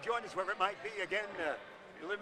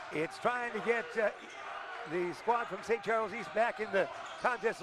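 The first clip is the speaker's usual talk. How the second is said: play-by-play; 4 dB louder than usual. Acted. Live play-by-play.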